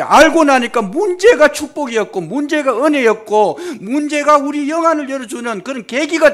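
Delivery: loud high impassioned preaching; fervent Korean revival preacher climax; preaching at full force. Acted. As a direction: drive the message home at the top of the voice.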